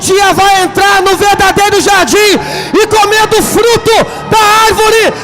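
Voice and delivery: high, loud, drawn-out pulpit cry; slow, shouted sermon climax; full-force preaching. Acted. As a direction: proclaim the climax at the top of your voice.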